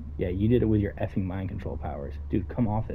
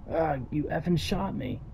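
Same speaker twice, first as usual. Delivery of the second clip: pained yelp; 7 semitones higher, slower than usual. Acted. Character hit by crossbow bolt.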